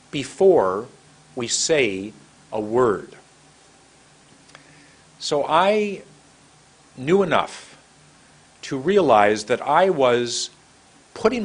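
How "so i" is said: usual speaking voice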